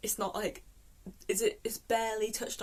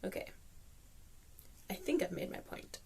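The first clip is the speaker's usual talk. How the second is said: whispered; 8 dB quieter than usual. Real, not acted. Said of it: "winding down the rant".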